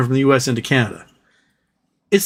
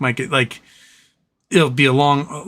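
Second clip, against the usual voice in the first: ordinary talk, nothing marked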